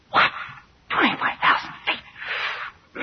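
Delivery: slow, whispered shock; aghast, hushed passenger reacting to the drop; stunned disbelief. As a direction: gasp it out slowly, barely any voice.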